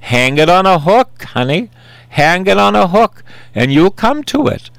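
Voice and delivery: put-on high, rising Texan accent; Marge Broccoli, Texan accent. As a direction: imitate a coaxing Texan woman. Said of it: "She says".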